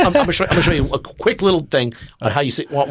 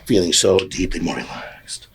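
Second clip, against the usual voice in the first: hypnotic induction; 5 semitones lower than usual. Acted. Hypnotist induction over music.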